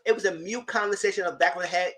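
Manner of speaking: normal talking voice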